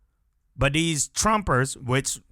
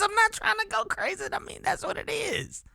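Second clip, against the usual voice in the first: high, giggling through the words; laughing; 4 dB quieter than usual. Real, not acted. Laughing through repeated phrase.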